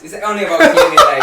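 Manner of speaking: laughing through the words. Real, not acted. Guest laughing about chores with his girlfriend. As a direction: giggle it out, a bit embarrassed.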